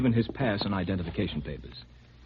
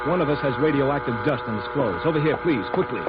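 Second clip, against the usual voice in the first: louder, higher urgent orders; urgent warning; 5 semitones higher, 4 dB louder than usual. Acted. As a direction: hurry him into the zone. Alarm, contamination warning.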